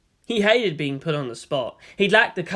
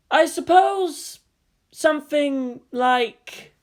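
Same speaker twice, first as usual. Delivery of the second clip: drawn-out, thoughtful hesitation; slow pondering; 10 semitones higher, 4 dB louder, much slower than usual. Acted. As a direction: stretch it out while thinking. Pondering slowly aloud.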